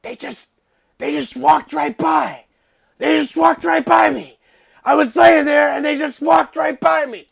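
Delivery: hammed-up injured climber crying out; dying man complaining. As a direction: play a wounded man wailing in mock anguish. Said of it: "and he's just going".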